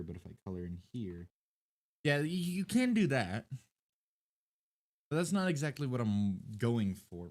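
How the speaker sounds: normal talking voice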